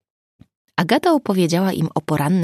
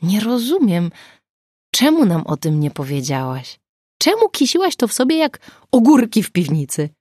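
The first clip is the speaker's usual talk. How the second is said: louder, higher reproach building to the last question; reproachful; 5 semitones higher, 5 dB louder than usual. A narrator's reading. Nina reproaching Agata for keeping secrets, louder and higher. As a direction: scold her for hiding it. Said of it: "rzuciła Nina z wyrzutem".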